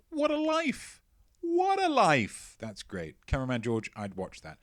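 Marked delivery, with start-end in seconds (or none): 0.1-2.6 mock awe. Gushing over Dickens casting.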